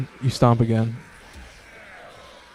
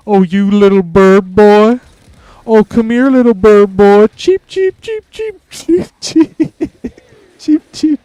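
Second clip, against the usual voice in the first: higher, louder, slow taunting voice, cheeps very high; mocking character voice; 7 semitones higher, 5 dB louder than usual. Taunting character mocks the bird boy, high and loud. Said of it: "This is kind of a fucked up character".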